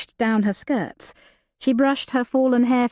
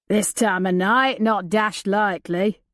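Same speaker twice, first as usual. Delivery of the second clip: rough outlaw. Acted. Outlaw at the campfire.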